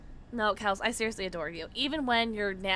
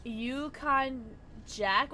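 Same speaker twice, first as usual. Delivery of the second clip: usual speaking voice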